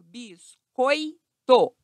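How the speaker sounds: "biscoito" very slowly as bis-coi-to; syllable by syllable. Shown as a demonstration. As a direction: stretch "biscoito", keeping oi together. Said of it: "na mesma sílaba".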